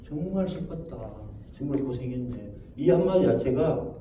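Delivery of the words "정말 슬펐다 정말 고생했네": soft, gentle sympathy; heart-melting words. Shown as a demonstration. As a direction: say it softly so it melts the heart. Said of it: "마음을 녹이는 말이 되는 거예요". (부드럽고 조용하게 마음을 녹이듯)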